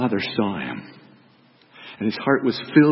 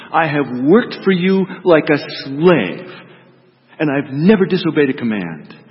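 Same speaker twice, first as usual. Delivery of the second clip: resentful complaint; 4 semitones higher, 6 dB louder than usual. Acted. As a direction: throw every grievance at your father. Older brother's bitter complaint.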